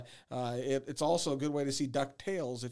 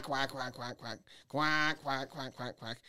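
greeting call quacks; slower than usual. Shown as a demonstration. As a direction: quack quack, dropping off. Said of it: "generally a five note quack".

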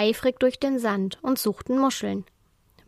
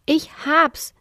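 higher, louder eureka shout; 6 semitones higher, 4 dB louder than usual. A narrator's reading. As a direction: blurt it out triumphantly. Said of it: "schrien Amadeus und Ricky gleichzeitig".

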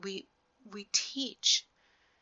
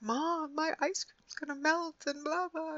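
put-on much higher, whining child voice; whiny kid; 10 semitones higher than usual. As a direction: mimic a daughter panicking about her ice cream. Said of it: "she's freaking out".

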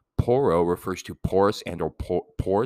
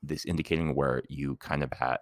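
usual speaking voice

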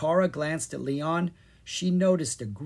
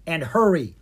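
ordering; 3 semitones higher, 6 dB louder than usual. A narrator's reading. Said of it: "Kara ordered".

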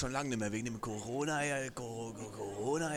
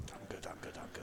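whispered thanks; 13 dB quieter, slower than usual. Acted. Hushed thanks to waitress.

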